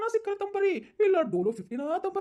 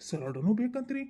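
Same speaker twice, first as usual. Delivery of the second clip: ordinary talk, nothing marked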